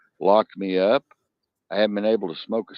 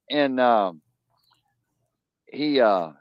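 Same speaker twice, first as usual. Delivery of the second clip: normal talking voice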